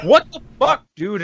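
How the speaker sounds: disgusted disbelief. Retold or imitated. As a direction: react to a terrible smell. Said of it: "I'm just like".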